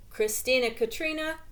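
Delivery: usual speaking voice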